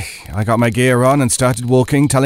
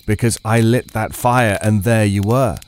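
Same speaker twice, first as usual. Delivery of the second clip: plain reading voice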